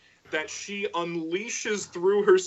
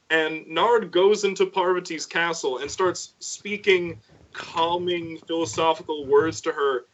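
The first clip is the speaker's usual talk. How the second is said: ordinary delivery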